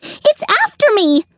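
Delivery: high-pitched alarmed squirrel; panicked. A narrator's reading. Hunted squirrel pleading to the chickens, panicked. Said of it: "out of breath and in a panic".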